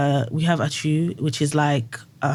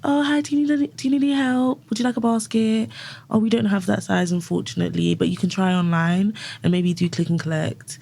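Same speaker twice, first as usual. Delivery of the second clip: high, sweet shop-floor voice; customer service voice; 5 semitones higher than usual. Retold or imitated. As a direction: greet a shopper brightly and politely. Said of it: "I have to be a little bit softer".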